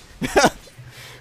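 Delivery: high, airy laugh; high breathy laugh. Real, not acted. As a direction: laugh at your own joke. Laughs at own boast.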